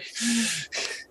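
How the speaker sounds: sound. Acted. [name] laughs at the riddle.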